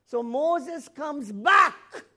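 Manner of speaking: slow, loud declaration of the return; booming preacher. Real, not acted. Preacher to congregation, slow loud booming. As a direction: thunder it out from the pulpit, drawn out.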